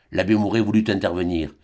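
usual speaking voice

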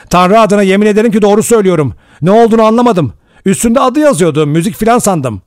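fast, high, loud self-defence; shouting. A narrator's reading. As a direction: blurt out a frantic sworn denial. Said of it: "diye bağırdı Rogers". (bağırarak kendini savunur gibi)

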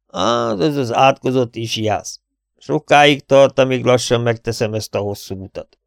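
put-on weary old client's lament; complaining old man. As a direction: grumble through an aching hip.

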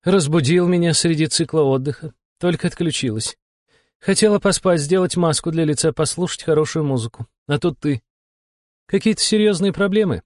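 high-pitched annoyed woman curator; peeved demon boss, high voice; irritated. A narrator's reading. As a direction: complain about being woken, voice pitched high. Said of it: "Голос кураторши прозвучал хрипло и раздражённо".

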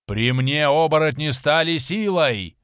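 loud, slow angry roar. A narrator's reading. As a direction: roar it like a bear. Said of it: "взревел вождь". (яростный рёв вождя)

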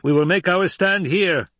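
loud, high commanding order. A narrator's reading. Emperor ordering his Sardaukar to make a stand. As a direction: command the troops to hold. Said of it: "the Emperor ordered".

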